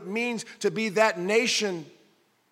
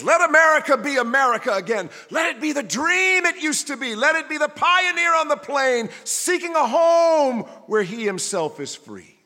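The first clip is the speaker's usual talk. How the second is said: higher and louder, proclaiming the dream; first voice of the poem; 7 semitones higher, 7 dB louder than usual. Acted. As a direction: ring it out like a rallying call. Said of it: "And the first voice".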